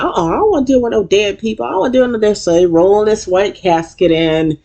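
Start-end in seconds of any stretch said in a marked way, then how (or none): 0.0-2.4 mock spooked refusal. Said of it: "And he was like".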